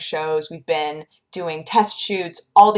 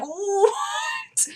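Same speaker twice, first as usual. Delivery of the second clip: whispered what, pitched very high; breathless disbelief; stunned whisper. Retold or imitated. Vlogger stunned at airbrush makeup, whispering what. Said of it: "I was like".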